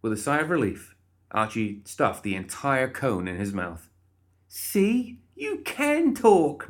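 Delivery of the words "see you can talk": very high, triumphant exclamation; delighted cry. A narrator's reading. Molly to the stammering guard, crying out delightedly. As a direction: cry out high, drawing out the words. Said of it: "Molly cried".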